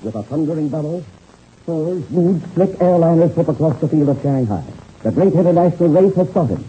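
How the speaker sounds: dramatic serial announcer read; radio announcer narration. A narrator's reading. Narrator signs off the episode to listeners, dramatic announcer read. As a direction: build suspense for the next episode.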